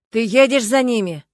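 loud shout into phone; yell. A narrator's reading. Stas yells into the phone, loud. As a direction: shout the question. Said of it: "орал он в трубку".

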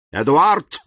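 резкий окрик «Эдуард!» с подъёмом; окликни сына резко; sharp call. A narrator's reading. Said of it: "крикнул Вильфор так резко". (резкий крик отца)

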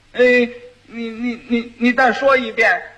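halting, stuttered request; dim-witted groom struggling to keep up; stammering fool. Acted. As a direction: trip over the first word, then push it out. Fool asks wife to repeat.

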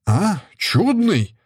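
high, slow scornful cry; mock her word in rage; furious shout. A narrator's reading. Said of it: "закричал отец в полной ярости". (в ярости, высоко и медленно)

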